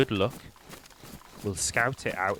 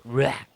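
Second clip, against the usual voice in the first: short, loud, raised zombie cry; zombie scream; 4 semitones higher, 6 dB louder than usual. Retold or imitated. Gamer showing viewers the usual zombie alert, mimicked zombie scream. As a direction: imitate a brief zombie screech. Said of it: "like every other zombie screams".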